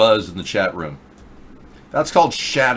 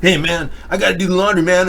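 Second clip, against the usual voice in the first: imitating meth heads; 8 semitones higher, 4 dB louder than usual. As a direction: lightly ham up a spaced-out meth head. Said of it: "walking around going".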